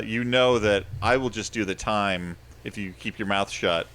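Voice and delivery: normal talking voice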